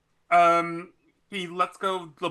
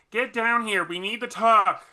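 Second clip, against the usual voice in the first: raised, higher calling voice; yelling back; 5 semitones higher, 4 dB louder than usual. Acted. Heliodor summons Jens down to talk, calling out. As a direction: call out an order across the distance. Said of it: "he yells back".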